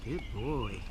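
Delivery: low, quiet words to the dog; soft dog talk. Real, not acted. Coaxing the dog with a treat, low and quiet. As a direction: murmur to the dog.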